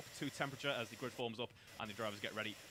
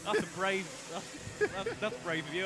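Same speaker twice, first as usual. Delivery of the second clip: mock surprise; 8 semitones higher, 6 dB louder than usual. Real, not acted. Teasing banter over accents.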